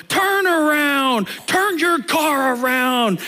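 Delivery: slow, drawn-out yell, pitched high; mock furious yell at a car window; angry shout. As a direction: shout it at a driver going the wrong way. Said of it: "like, angry".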